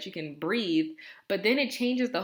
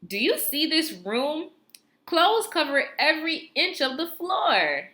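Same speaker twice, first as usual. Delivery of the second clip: higher and louder excited read; exciting voice; 10 semitones higher, 4 dB louder than usual. Shown as a demonstration. Teacher reads the second example aloud, excited. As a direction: sell the shock at the messy room. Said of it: "read it with my exciting voice".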